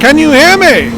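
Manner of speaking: shouting over roaring wind, high and loud; yelling over hurricane winds. Pretend. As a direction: storm reporter yelling into the gale. Mock live storm reporter.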